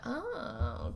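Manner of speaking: dawning realization. Retold or imitated. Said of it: "kind of idea".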